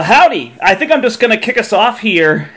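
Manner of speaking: normal talking voice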